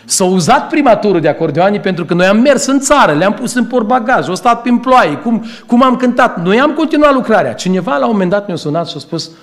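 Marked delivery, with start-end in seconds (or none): 0.1-7.5 raised, louder preaching voice. Acted. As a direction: preach it out with rising fervour. (predică cu glas ridicat)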